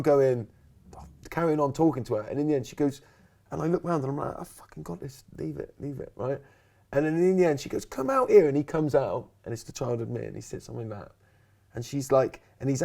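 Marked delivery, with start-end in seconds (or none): 4.4-6.1 hushed aside. Retold or imitated. Guest on air fending off an interruption, hushed. 7.9-8.5 high, loud mock summons; calling out. Talk show host calling the child out, loud and high.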